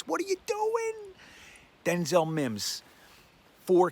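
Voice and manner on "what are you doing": high-pitched mock exclamation. Fan teasing coach Adam Gase, high-pitched mock amazement.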